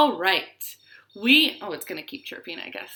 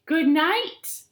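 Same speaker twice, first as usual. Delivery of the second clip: high, loud mosquito buzz; buzzing mosquitoes; 11 semitones higher, 7 dB louder than usual. Acted. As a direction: buzz it like tiny mosquitoes among the stars. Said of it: "buzzed the mosquitoes".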